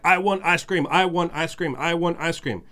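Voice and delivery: put-on high, loud whining kid demanding ice cream; mock child tantrum, high and loud; whiny child. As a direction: whine it like a tired toddler at bedtime.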